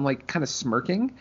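ordinary talk

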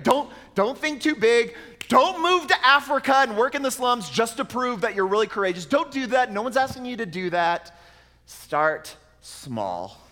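high, loud emphatic preacher warning; forceful preaching. Acted. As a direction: warn them off, full pulpit force. Preacher urging the listeners.

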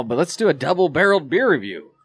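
ordinary delivery

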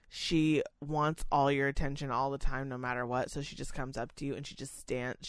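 normal talking voice

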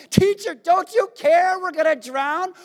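high, loud panicked cry at the teacher; frantic disciples shouting over the storm. Retold or imitated. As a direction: yell it like men sure they'll drown. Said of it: "shouting at him".

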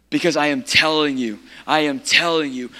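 usual speaking voice

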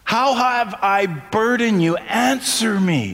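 slow, lightly voiced God asking his people; a hint of God's voice, slow and searching; God pleading. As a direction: draw each question out slowly, like a hurt father. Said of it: "This is God talking".

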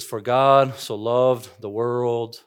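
slow, weighty delivery, then a long pause; dramatic pauses. Retold or imitated. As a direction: hold the line, let the silence land. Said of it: "he pauses dramatically after each line".

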